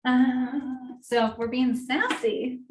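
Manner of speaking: ordinary delivery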